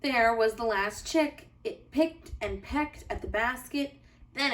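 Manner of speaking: put-on gentle, lively read-aloud for children; cozy storybook narration for little listeners; bedtime story reading. A narrator's reading. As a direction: read it like a bedtime picture book.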